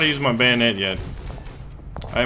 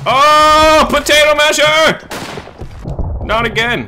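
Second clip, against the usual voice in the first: real alarmed yell, much higher and louder; panicked shout; 11 dB louder, slower than usual. Real, not acted. Spotting an enemy grenade, yelling in real alarm. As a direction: shriek a warning at the grenade. Grenade lands near him.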